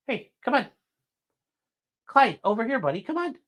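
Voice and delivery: high coaxing call to a pet; high pet-talk coaxing the dog to come. Real, not acted. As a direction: coax the dog over, high and sweet.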